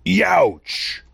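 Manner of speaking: stung dragon's exaggerated youch; big dragon voice. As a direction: yelp it like a wounded dragon. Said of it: "Big dragon voice".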